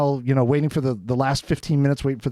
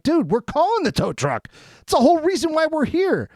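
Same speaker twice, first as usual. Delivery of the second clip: higher-pitched exasperated comeback, not shouted; protesting in disbelief at normal volume; retold indignant protest; 9 semitones higher than usual. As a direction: argue your case in a raised pitch. Retells his argument with owner.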